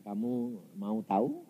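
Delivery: low, very soft voice of God; calm divine question. Retold or imitated. God offering the preacher an answer, low and soft. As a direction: ask it low and gently.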